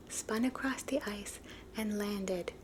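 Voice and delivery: ordinary delivery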